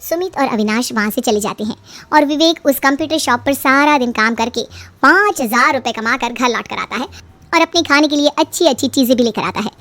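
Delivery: plain reading voice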